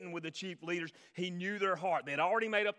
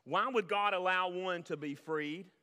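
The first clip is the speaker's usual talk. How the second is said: ordinary delivery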